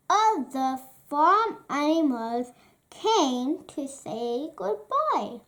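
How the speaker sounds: usual speaking voice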